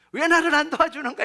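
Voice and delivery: high, loud retold grumble; imitated anger. Worker left alone with the chores, in mock angry complaint. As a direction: act out resentment at getting no help. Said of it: "이렇게 막 화가 나는 거예요".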